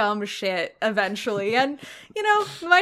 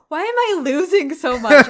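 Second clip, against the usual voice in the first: louder, puzzled whine; baffled whine; 4 semitones higher, 8 dB louder than usual. Retold or imitated. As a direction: complain like you're baffled. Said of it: "and I'm like".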